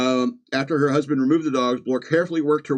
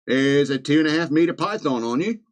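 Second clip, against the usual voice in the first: put-on Australian accent; broad comic Australian voice; 2 semitones higher than usual. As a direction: do a joking Aussie husband. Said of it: "Oh, this is Australia".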